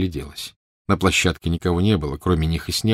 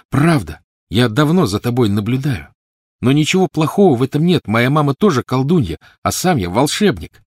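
put-on high, louder boyish insistence; eager child explaining; 7 semitones higher, 5 dB louder than usual. A narrator's reading. Snape telling Lily they are both magical, eager. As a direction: convince her, brimming with eagerness.

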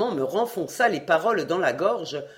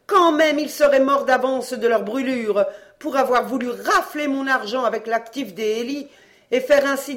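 bossy commanding mother; 6 semitones higher, 4 dB louder than usual. A narrator's reading. Mother giving orders, held figure.

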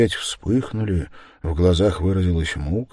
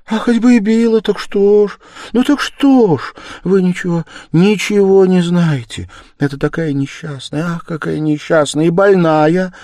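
very high, loud, impassioned pleading; desperate defence; 10 semitones higher, 8 dB louder than usual. Acted. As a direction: cry out for her, agitated and anguished. Sonya desperately defends Katerina Ivanovna. (отчаянно, страстно заступаясь)

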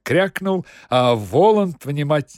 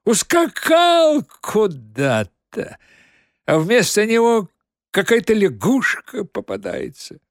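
muffled false voice; 10 semitones higher, 6 dB louder than usual. A narrator's reading. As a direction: keep the smothered false voice. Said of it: "задушенным и фальшивым голосом". (сдавленный фальшивый голос)

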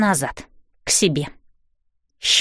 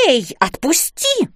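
mild yell; 4 semitones higher, slower than usual. A narrator's reading. Zoya grabbed by the arm, mild yell. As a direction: cry out in protest without real volume. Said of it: "закричала Зоя".

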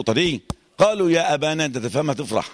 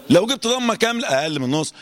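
usual speaking voice

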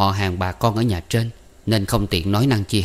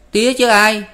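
put-on higher, louder kid reply; boy Năm; 13 semitones higher, 7 dB louder than usual. A narrator's reading. Năm telling Tư Cầu who sent him, higher and louder. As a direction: toss it back like a boy.

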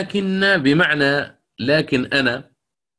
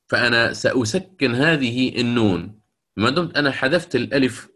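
normal talking voice

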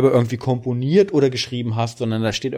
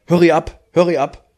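put-on loud, higher German-accented telling-off; German-accented English; 4 semitones higher, 6 dB louder than usual. TV personality scolding a car washer, German accent. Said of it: "in diesem Englischen mit deutschem Akzent".

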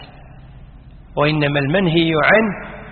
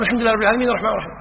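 rushed follow-along; 4 semitones higher, much faster than usual. Shown as a demonstration. Teacher showing the worshipper racing to keep up. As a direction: hurry right behind him. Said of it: "تقول".

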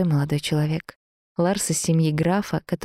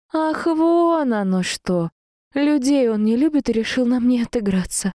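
indignant sarcasm; 8 semitones higher than usual. A narrator's reading. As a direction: scoff in a lifted, offended voice. Heroine indignant at his dislike.

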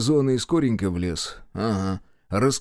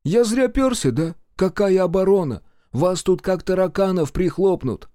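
very high, loud protesting voice; alarmed supply officer; 9 semitones higher, 4 dB louder than usual. A narrator's reading. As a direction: wail indignantly in a high pitch. (возмущённый интендант, высоко и громко)

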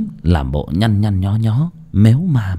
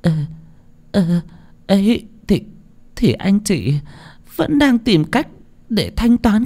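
high, panicky woman's voice, slowing; flustered pleading; 13 semitones higher than usual. A narrator's reading. Wife panicking before the collector, high and stammering. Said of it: "Mụ cuống cuồng xua tay".

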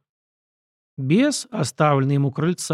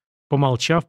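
usual speaking voice

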